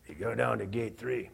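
low, hushed gangster voice offering a tip; mafia guy. Retold or imitated. Guard tips preacher off, low hushed gangster voice. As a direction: lean in and tip him off quietly. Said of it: "Guido, some mafia guy".

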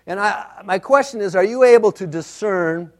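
ordinary delivery

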